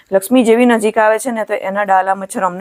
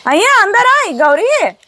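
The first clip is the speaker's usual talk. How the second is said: calling the cow; 8 semitones higher than usual. Real, not acted. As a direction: call her in from a distance. Calling cow Gauri inside.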